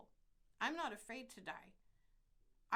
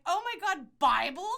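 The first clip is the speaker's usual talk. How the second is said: very loud, high Californian gush; mock Kardashian talk; 7 semitones higher, 17 dB louder than usual. Nurse reacting to a fellow Californian, mock Kardashian accent. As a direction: parody reality-star California speak. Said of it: "Like the Kardashians say".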